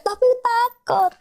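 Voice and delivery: high, drawn-out imitation of a scared child; mock fear. Counselor imitates Mario scared of the injection, mock fear. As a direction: stretch it out high, playing frightened.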